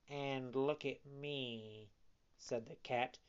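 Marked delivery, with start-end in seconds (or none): 0.1-1.9 slow, soft, subdued appeal; echoing cat. A narrator's reading. Blind cat echoing fox's warning, slow and soft.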